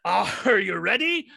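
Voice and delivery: slow, rising stage call; captain repeating his call. Retold or imitated. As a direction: imitate a captain demanding the crowd's answer. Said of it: "So he goes".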